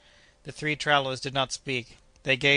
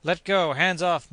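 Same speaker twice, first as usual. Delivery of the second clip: raised, higher desperate cries; hoarse grating moan; 5 semitones higher, 7 dB louder, slower than usual. A narrator's reading. Struggling Scot to Joe, hoarse grating cry. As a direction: fight him off in a grating voice. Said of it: "moaned the Scot in a hoarse, grating voice".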